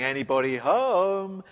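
a hint of nervous lepers calling out; lightly voiced, tentative call into the empty camp; timid call. Preacher voicing starving lepers entering the camp, lightly tentative.

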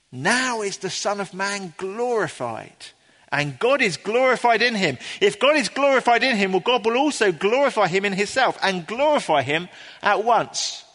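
high, ringing declaration of glory; triumphant. Acted. Preacher reading out Jesus' words of glory, triumphant. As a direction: proclaim it loud and high in triumph. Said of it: "it's a statement of great triumph".

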